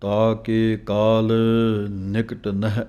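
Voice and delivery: slow, measured chanting of scripture; slow Gurbani recitation. Shown as a demonstration. Teacher reciting the verse slowly for students to follow. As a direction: recite each phrase slowly with pauses.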